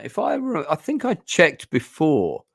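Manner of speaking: high, excitable register; suddenly high-pitched. Real, not acted. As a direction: voice shooting up high mid-thought. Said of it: "my voice just went really high pitched".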